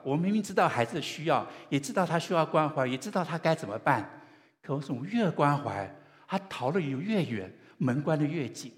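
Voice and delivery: loud, emphatic parental frustration; exasperated parent. Acted. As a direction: voice a parent at wits' end, loud and high. Voicing a frustrated parent's struggle.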